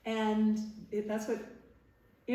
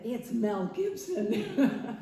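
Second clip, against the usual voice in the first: slow, louder nervous laugh through the words; 4 semitones higher, 5 dB louder, much slower than usual. Retold or imitated. Telling her Paramount friend why she's nervous, laughing slowly. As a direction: laugh it out, starstruck and jittery. Nervous before meeting Mel Gibson.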